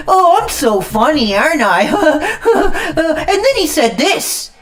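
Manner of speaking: high, giggling mock-loser voice; mocking impression of video host. Angry fan mimicking the YouTuber reading his posts, high mocking giggle. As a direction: mimic him with fake, whiny laughter between phrases. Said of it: "He literally just sat there".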